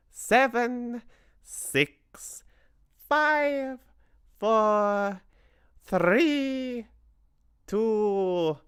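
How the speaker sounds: high witch voice, building to a loud slow shout; a witch relishing the final count; witch's countdown cry. A narrator's reading. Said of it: "cried the Grand High Witch".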